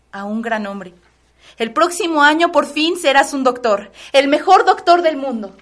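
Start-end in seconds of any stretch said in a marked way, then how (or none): 1.6-5.5 louder, proud mother's forecast; proud excitement. Acted. Mother to her student son, proudly excited. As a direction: swell with pride to the last word.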